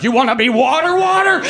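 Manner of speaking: very high, squeaky talking moon. Acted. The moon talking to the water overnight, high silly voice. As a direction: pitch it way up as the moon. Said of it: "and the moon's like".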